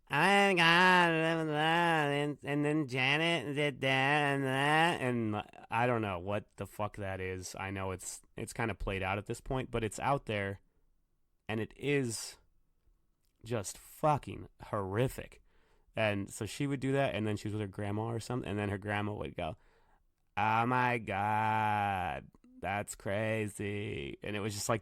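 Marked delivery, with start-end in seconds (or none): 0.1-5.0 high, slow, drawn-out valley girl mimic; mock the vapid twenty-two-year-old talk, pitched way up. Mocking the annoying girl at dinner, high valley girl voice. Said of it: "the twenty-two-year-olds that they talk like". 20.4-24.2 slow, drawn-out hint of an old lady; lightly voiced grandma, stretched slow; old lady version. Grandma copying the girl, slow mock old lady. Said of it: "the old lady version".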